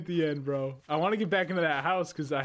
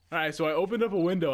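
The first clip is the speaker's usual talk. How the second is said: usual speaking voice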